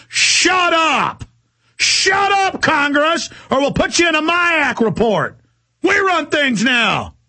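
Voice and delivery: high, forceful order to shut up; high-pitched imitation of an arrogant official; mock domineering retort. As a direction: bark it out as a bullying authority. Acting Northcom ordering Congress around.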